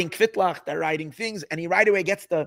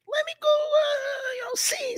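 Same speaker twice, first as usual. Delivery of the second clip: drawn-out high musing; slower than usual. Retold or imitated. Rabbi talks himself into going.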